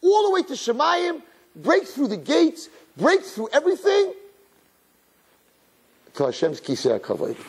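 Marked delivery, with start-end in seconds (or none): 0.0-4.1 high, ringing, stretched phrases; preacher soaring toward the throne; soaring build. A narrator's reading. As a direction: lift the voice as if rising to heaven. Voice soaring to heaven.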